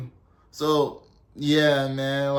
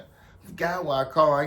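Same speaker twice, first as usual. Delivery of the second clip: usual speaking voice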